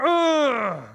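high strained groan, acted; pained groan. Preacher imitating wife groaning in labor. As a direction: groan as the next contraction hits. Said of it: "three and a half minutes later".

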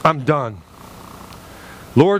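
normal talking voice

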